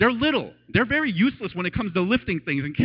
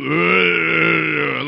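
demo groan; 2 semitones lower, 5 dB louder than usual. Shown as a demonstration. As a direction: groan it out like someone too weak to speak. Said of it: "as I do that".

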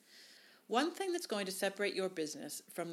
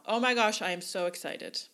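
gushing, much louder on oh my gosh; excited sign-off; 8 dB louder than usual. Shown as a demonstration. Wedding planner's email sign-off to clients, gushing loud excitement. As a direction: burst out with delight, then settle. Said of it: "if you can write in your email".

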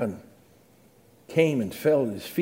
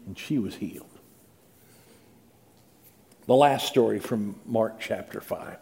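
ordinary talk

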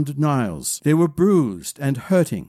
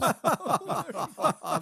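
high, light run of laughs; acted laughter; 13 semitones higher, 9 dB quieter than usual. Others laughing at Malady's joke, amused. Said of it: "made the others laugh some more".

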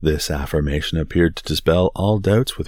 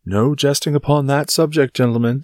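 higher, forceful warning; stern rebuke; 10 semitones higher, 6 dB louder than usual. Acted. Porthos forbids jesting about the queen, sternly. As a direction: cut the joking short.

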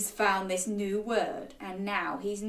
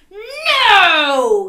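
loud, high yelled refusal; stubborn child's big no; defiant yell; 8 semitones higher, 13 dB louder than usual. A narrator's reading. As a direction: yell it out with fists clenched. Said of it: "yelled Ned".

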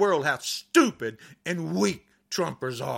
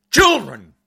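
louder, furious plea; angry outburst; 3 semitones higher, 7 dB louder than usual. Real, not acted. Defending tortured children, a real angry outburst. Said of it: "I can't do a podcast without getting angry".

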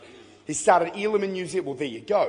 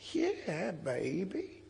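put-on old woman's voice; 8 dB quieter, much slower than usual. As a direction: play the old woman gently setting him straight. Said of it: "she goes".

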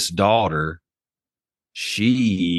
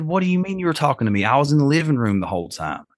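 baffled question; 7 semitones higher, faster than usual. Retold or imitated. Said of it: "I said".

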